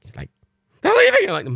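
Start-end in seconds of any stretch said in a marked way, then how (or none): 0.8-1.3 very high, loud, frantic squeal; panicking dog voice. Retold or imitated. Dog Matilda panicking as her owners leave, squealing very high and loud. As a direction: wail it like an abandoned puppy. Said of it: "my impressions of Reagan and Matilda".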